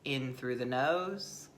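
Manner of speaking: soft, unhurried guiding voice with long pauses; guided meditation. Acted. Teacher leading listeners into the breath, guided meditation. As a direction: ease listeners inward, softly and gently.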